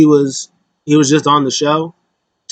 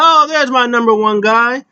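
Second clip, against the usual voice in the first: mock delighted greeting; 8 semitones higher than usual. Chad Gable greeting Otis backstage, hammed-up delight. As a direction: play a pleased coach hailing his favorite. Coach greets his wrestler in story.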